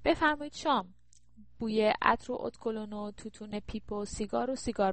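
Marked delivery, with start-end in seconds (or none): 0.0-0.9 high call to the dinner guests; raised, lilting summons. A narrator's reading. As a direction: announce it to the whole room. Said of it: "بلند گفت".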